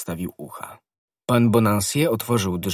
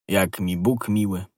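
usual speaking voice

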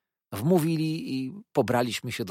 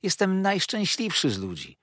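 high-pitched burst of happiness; elated, lifted character voice; happy exclamation; 9 semitones higher than usual. A narrator's reading. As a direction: declare it with overflowing delight. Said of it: "zawołał".

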